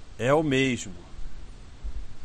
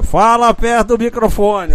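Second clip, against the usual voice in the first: much louder and higher, bellowed; loud call; 9 semitones higher, 12 dB louder than usual. Retold or imitated. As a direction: bark the order into the mic. Retells himself telling guest loudly.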